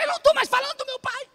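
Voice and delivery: high, mock annoyed wife; wife's annoyed voice. Mimicking his wife scolding him, high and mock annoyed. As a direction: squeak out a bothered wife.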